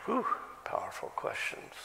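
soft whispered whew; awed whisper. Real, not acted. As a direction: breathe it out in awe. Aside after list of questions.

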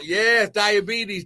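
usual speaking voice

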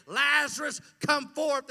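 loud call to the dead man; commanding call. Retold or imitated. Jesus calling Lazarus from the tomb, loud command. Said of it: "He knows he's going to say".

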